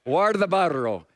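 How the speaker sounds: Guardabarro with a rolled R on the rr; Spanish pronunciation. Shown as a demonstration. Pastor showing the congregation a favorite Spanish word, trilled R. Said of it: "You hear the R's rolled?".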